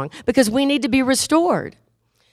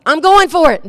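louder, higher defiant insistence; headstrong; 6 semitones higher, 5 dB louder than usual. Acted. Sheep insisting against God's warning, headstrong. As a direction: override the warning boldly.

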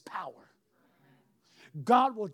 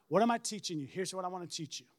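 normal talking voice

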